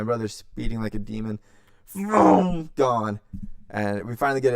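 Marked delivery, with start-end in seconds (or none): 1.9-2.7 loud, high revving "vroom"; big roaring car noise; engine vroom. Retold or imitated. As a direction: imitate a car tearing away. Imitates speeding car.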